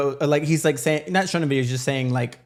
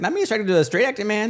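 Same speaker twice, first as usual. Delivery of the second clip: pitched up, teasing self-mockery; high playful self-roast about a crush; mock disbelief; 8 semitones higher than usual. To her cohost, mocking her own crush in a higher voice.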